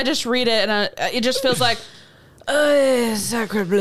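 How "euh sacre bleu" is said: mock French accent. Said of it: "I love his apology in French".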